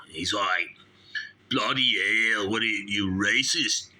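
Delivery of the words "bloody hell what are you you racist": indignant mock Aussie; Australian accent. Snake handler accuses host of racism, mock Australian accent. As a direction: protest in a put-on Australian accent. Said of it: "he's from Australia".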